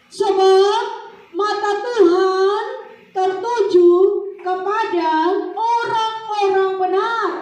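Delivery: high, loud, slow half-sung proclamation; preaching at full force. Acted. Preacher sing-song proclaiming the verse to the church. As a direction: chant the verse out with power. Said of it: "verse repeated at full force".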